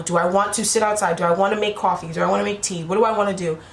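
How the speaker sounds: ordinary delivery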